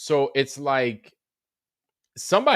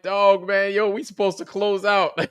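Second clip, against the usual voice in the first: laughing protest; 7 semitones higher than usual. Retold or imitated. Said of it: "I was like".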